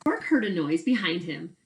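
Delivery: ordinary delivery, nothing marked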